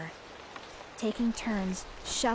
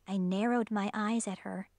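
plain reading voice

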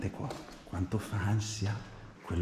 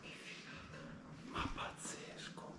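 hushed whisper, sneaking; 14 dB quieter than usual. Real, not acted. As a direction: whisper so nobody hears. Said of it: "scusate il volume".

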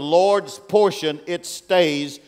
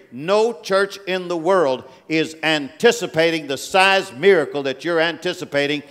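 usual speaking voice

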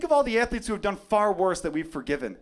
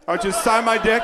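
louder, higher shouted plea; shouted fan request; 4 semitones higher, 7 dB louder than usual. Pretend. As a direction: yell it the second time, begging.

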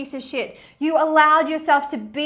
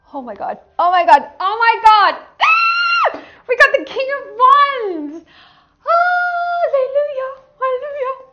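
high, loud shrieking celebration; ecstatic; 7 semitones higher, 8 dB louder than usual. Real, not acted. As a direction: shout with overwhelmed joy at the card. Thrilled at drawing King of Wands.

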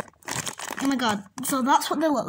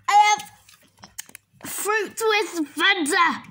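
excited reveal; 8 semitones higher, 3 dB louder, much slower than usual. Real, not acted. Reveals exciting new drink.

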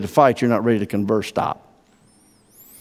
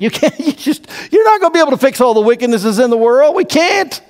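high, rising, louder urging; excited preaching; 12 semitones higher, 4 dB louder than usual. Real, not acted. Pastor presses church not to get heated, pitch soaring. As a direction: push it up to a near shout. Preaching climax, laughing then shouting.

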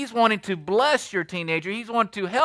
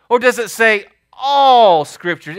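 slow, loud, ALL stressed; emphatic challenge; 3 semitones higher, 8 dB louder, slower than usual. Acted. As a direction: press the congregation hard. Preacher hammers the point.

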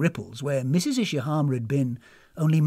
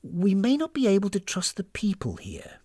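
higher, pointed voice of mistrust; suspicious woman character; 6 semitones higher, 5 dB louder than usual. Acted. Warning companion about overfriendly hosts, suspicious. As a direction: voice growing suspicion, leaning on key words.

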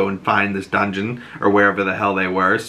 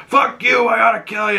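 angry shout; 11 semitones higher than usual. Retold or imitated. Sal postures at Oz from prison, mock angry shout. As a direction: bark it like a caged tough guy. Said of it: "posture up and peacock".

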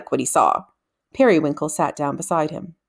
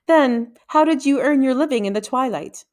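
a lightly higher, pointed Petavox; lightly raised, sharp interrogator's voice; 6 semitones higher than usual. A narrator's reading. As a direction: needle the boy in a high voice. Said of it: "his voice was high-pitched and sharp".

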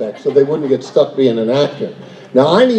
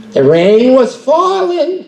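dramatic narration; 8 semitones higher, much slower than usual. Shown as a demonstration. Shows the cue line properly.

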